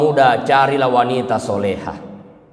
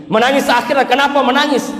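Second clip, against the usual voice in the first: rising preacher question; 11 semitones higher than usual. Real, not acted. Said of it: "rhetorical question to congregation".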